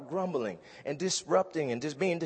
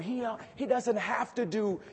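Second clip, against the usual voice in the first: higher, rising to louder preaching; swelling preacher cadence; building preaching; 6 semitones higher, 4 dB louder than usual. Acted. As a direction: lift the congregation with each if.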